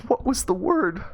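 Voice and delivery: high-pitched hysterical plea; acted maternal meltdown; mom breaking down. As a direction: wail it as a desperate mother. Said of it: "mom just starts breaking down".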